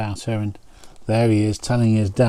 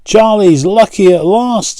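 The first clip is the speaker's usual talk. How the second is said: high, loud disbelieving cry; disbelief; 9 semitones higher, 7 dB louder than usual. A narrator's reading. Another fisherman marvels in disbelief, high and loud. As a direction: marvel that he's finally lucky. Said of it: "shaking his head in disbelief".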